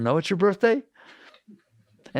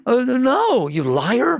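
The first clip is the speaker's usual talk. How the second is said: higher, louder hammed-up denial; mock indignant denial; 8 semitones higher, 4 dB louder, much slower than usual. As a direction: play the caught liar protesting loudly. Accused person denies, acted.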